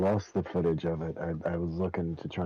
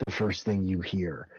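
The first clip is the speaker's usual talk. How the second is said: normal talking voice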